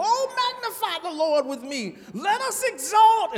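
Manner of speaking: high, loud, laughing churchy sing-song; mock super saint. Preacher mocking super saints at testimony time, laughing high church voice. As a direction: ham up the showy saint reciting a whole chapter. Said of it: "those super saints, and they come with a whole chapter".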